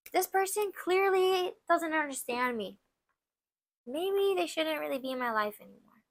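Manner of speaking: quietly acted inner realization; reflective deciding. Person deciding about someone in their life, softly reflective. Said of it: "either you can decide".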